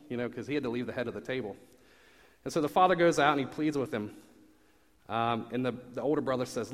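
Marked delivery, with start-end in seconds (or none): none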